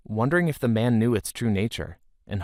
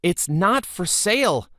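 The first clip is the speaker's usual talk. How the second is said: higher, desperate cry of refusal; boy crying out, a touch panicked; cried out; 9 semitones higher, 3 dB louder than usual. A narrator's reading. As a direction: refuse loudly as they grab the spear. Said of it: "Micah cried".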